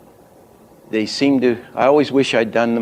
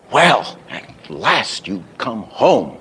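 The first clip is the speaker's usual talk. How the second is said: slow, each of "Well", "last", "home" bumped; body-sync beats; much slower than usual. Shown as a demonstration. Teacher miming body sync, slow punched accents. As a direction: beat out "Well", "last" and "home" with space between. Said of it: "they did kind of body sync".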